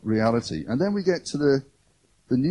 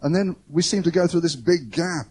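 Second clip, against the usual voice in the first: normal talking voice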